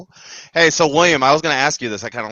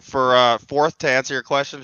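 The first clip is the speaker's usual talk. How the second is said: normal talking voice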